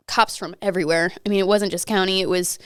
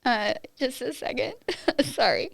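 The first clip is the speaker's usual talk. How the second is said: tearful; 3 semitones higher, 4 dB quieter than usual. Real, not acted. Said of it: "You wanna take a break?".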